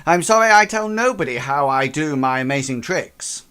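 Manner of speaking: a touch of a proud English magician, brushing them off; English accent. Acted. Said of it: "says it in quite an English accent now".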